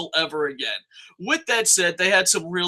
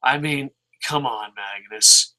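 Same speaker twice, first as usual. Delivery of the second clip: ordinary talk, nothing marked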